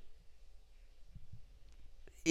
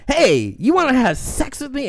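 mock Chris Rock come-on; Chris Rock impression; 11 semitones higher than usual. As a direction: blurt the pickup line like a cocky comic. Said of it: "the Chris Rock".

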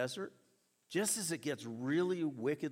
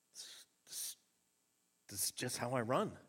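out of breath; 3 semitones lower than usual. Retold or imitated. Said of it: "I can't talk".

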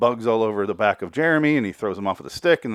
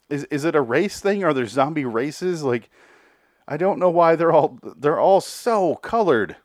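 baffled; 6 semitones higher than usual. Retold or imitated. Baffled reaction to rainbow zombies.

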